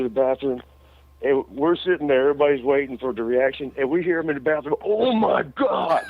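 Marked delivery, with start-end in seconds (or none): 4.8-6.0 high, drawn-out cry of horror at the mirror; shocked cry. Retold or imitated. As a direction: let it out slow and high, aghast. Groggy friend sees shaved head.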